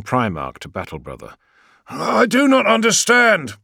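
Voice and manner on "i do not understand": high, loud bewildered warrior; confused warrior. Acted. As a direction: blurt out confusion. Gargo's voice.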